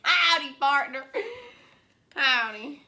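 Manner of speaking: loud hammed-up cowboy greeting; country accent. Friends trying loud country voices on camera. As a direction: belt it out like a rodeo cowboy. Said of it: "Okay, cr-, country, country".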